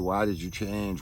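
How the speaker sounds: normal talking voice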